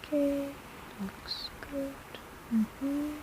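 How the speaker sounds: hushed, focused murmuring while drawing; soft murmur. Acted. Healer murmuring softly while drawing runes on your hand. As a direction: barely voice it, concentrating.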